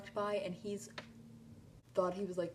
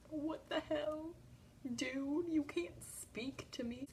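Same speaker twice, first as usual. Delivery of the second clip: flustered squeal; 9 semitones higher, slower than usual. Retold or imitated. Harry greets her.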